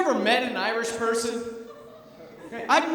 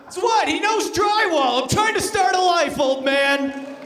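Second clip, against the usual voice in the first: loud, higher indignant yell at dad; mock shouting son; 8 semitones higher, 4 dB louder than usual. Son shouting back at his father about his boyfriend. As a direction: shout it at your old man, half laughing.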